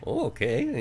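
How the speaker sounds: high-pitched mock "oh, okay"; imitated agreeable reply. Host imitates Jason giving in to a request, high-pitched.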